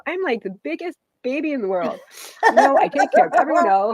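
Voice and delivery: laughing. Real, not acted. Laughing through tears.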